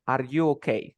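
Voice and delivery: "Are you okay" with no rise on okay; wrong question tune. Shown as a demonstration. Teacher showing student what never to say, unstressed statement tune. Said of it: "tú nunca vas a decir".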